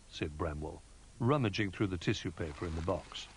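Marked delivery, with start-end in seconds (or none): none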